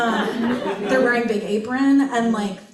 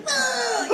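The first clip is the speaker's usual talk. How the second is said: mock whiny ugh; 13 semitones higher than usual. Mock cry in quote.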